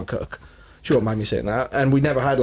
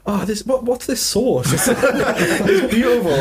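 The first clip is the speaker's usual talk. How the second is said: high-pitched, delighted awe, retold for laughs; hammed-up amazed delight, pitched high; retold gushing wonder; 10 semitones higher, much slower than usual. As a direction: gush naively over a new discovery, laughing at yourself. Retold naive gushing over gravy.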